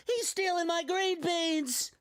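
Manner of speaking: very high, slow mock-outraged shout; Jolly Green Giant. Mascot complaining about bean thieves, high hammed-up shout. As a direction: holler like a cartoon mascot being robbed. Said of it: "Jolly Green Giant".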